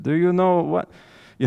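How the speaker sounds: put-on a quick stab at a thick Cuban accent, high and loud. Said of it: "in his thick Cuban accent".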